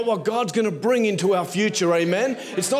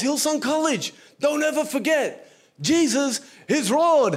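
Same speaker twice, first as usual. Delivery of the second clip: higher voice, slowing right down on Jesus is Lord; mock grand sermon ending; 5 semitones higher than usual. Imitating preacher's big closing line.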